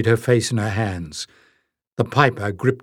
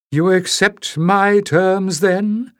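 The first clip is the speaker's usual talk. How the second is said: higher, slow, probing question; piper asking; 8 semitones higher, slower than usual. A narrator's reading. The piper asks Leif whether he accepts, slowly. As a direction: press for an answer. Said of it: "the piper asked".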